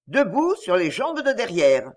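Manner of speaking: ordinary delivery, nothing marked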